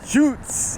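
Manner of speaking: the local way to say "shoots", pitched high; Pidgin shoots. Shown as a demonstration. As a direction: lift "shoots" up high and let it ride up. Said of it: "You gotta say it like that".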